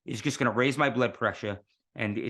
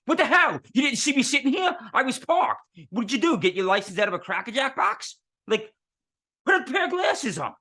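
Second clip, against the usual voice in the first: much higher and louder furious shout; angry yelling; 12 semitones higher, 3 dB louder than usual. Retold or imitated. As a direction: scream at the driver who backed into your car. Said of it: "yelling and screaming".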